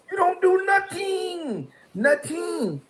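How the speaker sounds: a high, drawn-out shout of scorn; high scornful shout. Real, not acted. Mocking his rival on camera with a high slow shout. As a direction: shout him down, slowly. Calling him out loudly.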